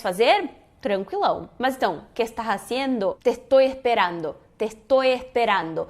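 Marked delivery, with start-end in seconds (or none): 2.1-5.9 "estás", "estoy", "esperando" with each S breathed as H; aspirated S. Shown as a demonstration. Teacher giving Argentine-style examples to students, aspirated S throughout. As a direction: say "Te estoy esperando" like "te ehtoy ehperando". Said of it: "que é o S aspirado".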